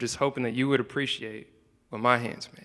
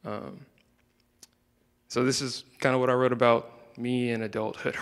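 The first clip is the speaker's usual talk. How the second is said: usual speaking voice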